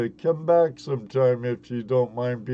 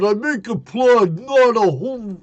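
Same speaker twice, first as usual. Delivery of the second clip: loud, high, fast Brooklyn street voice; Brooklyn tough talk; 9 semitones higher, 9 dB louder than usual. Pretend. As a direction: bark it like a Brooklyn wiseguy. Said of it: "get a little more Brooklyn on her".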